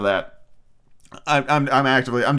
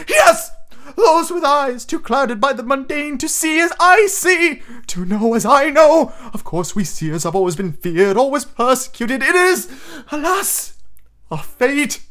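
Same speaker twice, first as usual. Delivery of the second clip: high, slow, wavering melodrama; Professor Trelawney; 14 semitones higher, 4 dB louder than usual. A narrator's reading. Persecuted seer lamenting to student, dramatic wavering voice. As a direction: declaim like a tragic seer, loud and high, then lamenting. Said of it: "dramatic, wavering voice".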